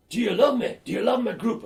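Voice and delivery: imitating a broad Scottish singer, high and loud; mock Scottish frontman teasing the crowd; broad Scottish voice asking the crowd for love; broad Scottish accent. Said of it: "this is a broad Scottish accent".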